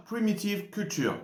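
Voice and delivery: English title said with a strong French accent; self-mocking French accent on "Primitive Culture". Real, not acted. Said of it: "mon accent anglais super francophone".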